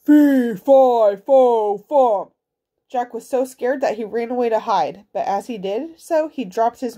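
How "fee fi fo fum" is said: booming, loud giant roar; giant's shout. A narrator's reading. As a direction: bellow each word like a giant.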